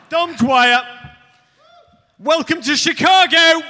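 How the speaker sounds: loud, high, slow greeting; big welcome. Real, not acted. Host greeting the guest at the top of his voice. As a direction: boom out the welcome.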